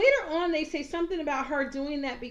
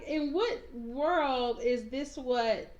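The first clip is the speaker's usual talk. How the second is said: ordinary talk, nothing marked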